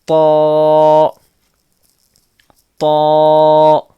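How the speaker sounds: a heavy, full-mouthed تو said loud; teacher punches تو out heavy and loud; said bold. Shown as a demonstration. Teacher shows students a heavy letter, loud. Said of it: "bold پڑھنا ہے".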